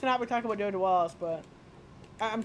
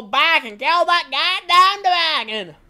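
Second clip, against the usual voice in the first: loud, raised declaration; rousing battle cry; 6 semitones higher, 11 dB louder, slower than usual. Pretend. Player vowing to kill the dragon, loud battle cry. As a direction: belt out the vow.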